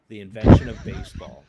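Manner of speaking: loud, punchy repeat of the title; emphatic loud repeat. Real, not acted. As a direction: announce it big. Punchline repeat, much louder.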